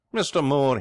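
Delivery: loud, high howl of protest; outraged yell. Acted. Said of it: "he yells".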